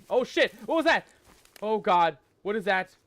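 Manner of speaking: loud, high startled yelp. Real, not acted. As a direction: jump at the sudden noise. Startled by noise in bushes.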